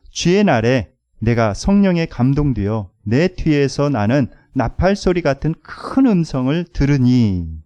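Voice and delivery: loud, slow, measured reading of scripture; scripture reading aloud. Acted. Preacher leading the congregation in reading, loud and measured. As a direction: lead the room through the verse, loud and slow.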